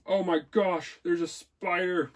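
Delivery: sudden high alarmed outburst; startled by a spider. Real, not acted. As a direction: jump at the sight of it.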